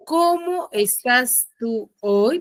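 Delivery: "¿cómo estás tú hoy?" drawn out at half speed; very slow read. Shown as a demonstration. Teacher to class, reading the example very slowly. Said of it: "pero el tú".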